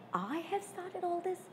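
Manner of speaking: a quiet, incredulous question back; taken aback. A narrator's reading. Venus taken aback by blame.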